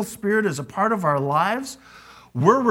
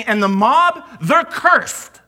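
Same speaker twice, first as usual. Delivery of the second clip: much higher, louder contempt for the mob; scornful condemnation; 7 semitones higher, 7 dB louder, slower than usual. Acted. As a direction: spit out the curse on the crowd. Pharisees condemning the crowd.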